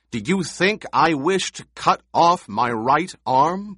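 high, loud challenging question; forceful demand. A narrator's reading. Paul confronting Stilgar over their duel, demanding. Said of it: "Paul demanded".

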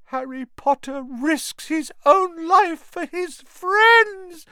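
high, slow, miserable ecstasy; moaning house elf. Acted. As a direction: moan it in anguished adoration. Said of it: "moaned Dobby in a kind of miserable ecstasy".

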